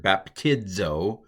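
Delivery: "baptizo" slowly, with dz in the middle; dz pronunciation. Shown as a demonstration. Teacher slowly demonstrating "baptizo" with a dz sound. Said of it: "I'm gonna do it as a D Z".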